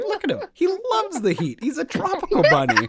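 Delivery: high, cheery put-on voice; high mock showman. Host mocks the guest presenting the dead rabbit, high and cheery. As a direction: sell the dead bunny like a proud showman. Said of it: "He's like".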